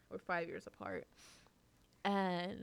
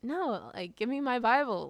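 indignant demand; 5 semitones higher, 6 dB louder than usual. Retold or imitated. Retold childhood sibling quarrel over Bible.

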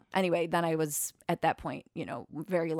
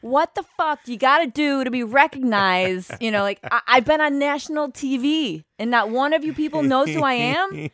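loud, much higher exasperated outburst; exasperated yell; 8 semitones higher, 9 dB louder than usual. Retold or imitated. Comic yelling at the audience about being on national TV, exasperated shout. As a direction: blow up at the crowd for not knowing you. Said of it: "he was just so irritated".

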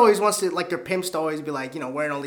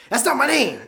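louder, higher mock protest; indignant pimp; 8 semitones higher, 5 dB louder than usual. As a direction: imitate an offended cartoon pimp. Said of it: "he's talking about".

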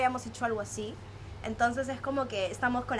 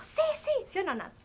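eager high friend voice; 12 semitones higher than usual. Retold or imitated. Acting out friend's eager reply.

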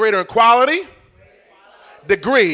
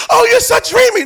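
swooning wife; 11 semitones higher than usual. Retold or imitated. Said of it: "wife swooning, retold".

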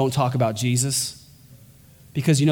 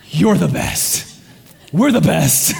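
laughing through it, louder, slow; laughing banter; 4 dB louder, slower than usual. Pretend. Trinity cheering each other, laughing loudly. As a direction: giggle out the compliment, mock-boastful. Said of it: "it'd be like this".

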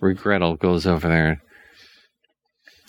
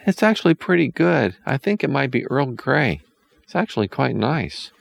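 put-on boy's voice; 9 semitones higher than usual. A narrator's reading. Hansel tastes the drugged tea.

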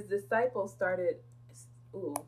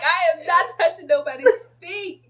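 loud, high mock refusal; disgusted students; 11 semitones higher, 15 dB louder than usual. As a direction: refuse outright, grossed out. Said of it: "When people were like".

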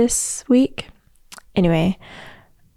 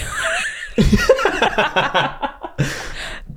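whispered laugh; 4 semitones higher than usual. Real, not acted. Whispered laugh after joke.

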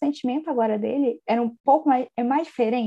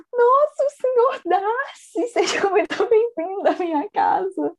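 much higher, louder, slow fawning welcome; gushing mother; 9 semitones higher, 4 dB louder, slower than usual. Retold or imitated. Mrs Bennet suddenly fawns over Mr Darcy, gushing. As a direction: ham up a starstruck mother greeting the rich suitor.

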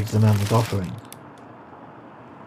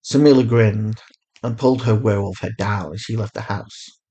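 ordinary delivery

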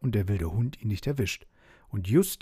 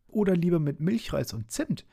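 high little Schnuddel voice; calling out; 8 semitones higher than usual. A narrator's reading. Schnuddel suggests a sweeter recipe, calling out high. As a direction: chime in high with a cheeky suggestion. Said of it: "rief Schnuddel".

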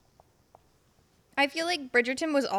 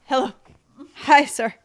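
eager host; 6 dB louder than usual. Pretend. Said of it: "I'm gonna be like".

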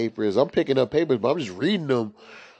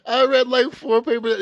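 laughing high; 12 semitones higher than usual. Real, not acted. Laughing at own confession.

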